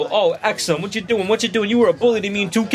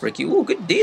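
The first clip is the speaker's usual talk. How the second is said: ordinary delivery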